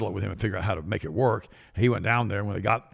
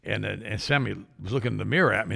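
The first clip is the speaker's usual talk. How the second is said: usual speaking voice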